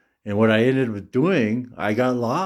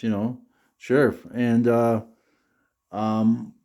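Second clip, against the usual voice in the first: normal talking voice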